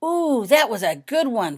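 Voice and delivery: slow, loud, delighted ooh; excited exclamation. A narrator's reading. Painting cheering a clever move in the game, slow and delighted. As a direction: savour it out loud, impressed. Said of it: "an exclamation from Fiona".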